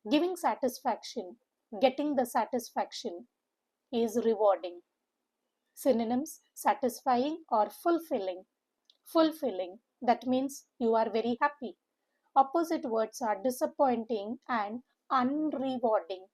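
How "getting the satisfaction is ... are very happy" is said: ordinary delivery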